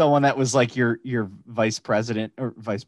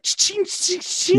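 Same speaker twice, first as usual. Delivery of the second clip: high, piercing key jingle; imitated jangling keys; much slower than usual. Imitating a teacher's keys walking in, high jingle. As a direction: jingle like a big bunch of keys. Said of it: "all their keys are like".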